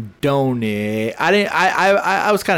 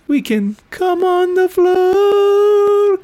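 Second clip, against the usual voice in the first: much higher and louder, very slow croon; drunk sing-song; 5 dB louder, much slower than usual. Retold or imitated. Drunk Corey crooning at his wife, high slow croon. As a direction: belt it out like a drunk serenade. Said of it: "he goes".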